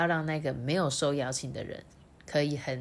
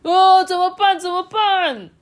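panicked frog; 13 semitones higher, 10 dB louder, slower than usual. Acted. Frogs fall into the milk.

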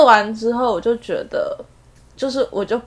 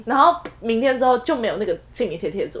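ordinary delivery

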